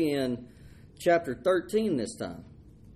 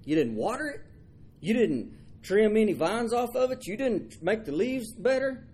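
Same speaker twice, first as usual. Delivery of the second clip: higher, firm listing of what Jonah didn't do; God scolding; 7 semitones higher than usual. Acted. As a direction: tick off each point like a patient father. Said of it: "And God says".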